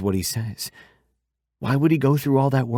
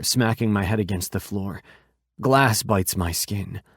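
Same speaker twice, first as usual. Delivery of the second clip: plain reading voice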